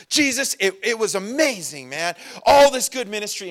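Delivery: high, excited gush; hyped-up retelling of good news; amped disciples. Retold or imitated. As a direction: gush like thrilled kids back from a trip. Disciples amped after mission.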